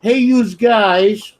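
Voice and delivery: loud, drawn-out Connecticut accent impression; mock Connecticut accent. Teasing a friend about his accent, mock Connecticut accent. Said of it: "You can hear the frickin' accent".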